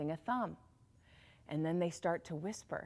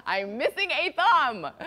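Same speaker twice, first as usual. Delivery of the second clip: higher and louder, laughing through the words; laughing complaint; 9 semitones higher, 8 dB louder, slower than usual. Acted. King complaining about his thumb.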